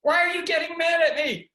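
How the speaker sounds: high, loud, indignant question; the wife's protest voiced high and loud; imitating his wife. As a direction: mimic the wife's defensive complaint. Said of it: "And she'd go".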